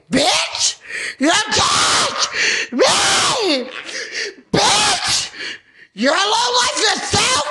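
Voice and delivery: real high-pitched, loud screamed tirade; furious screaming. Real, not acted. Raging at someone in uniform, screaming abuse. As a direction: scream each insult, stretching the words out.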